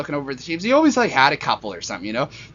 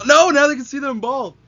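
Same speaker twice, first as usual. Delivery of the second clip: mock dismay; 10 semitones higher, 6 dB louder than usual. Mock horror at own bald look.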